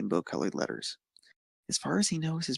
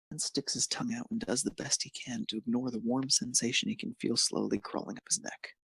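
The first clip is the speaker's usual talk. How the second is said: plain reading voice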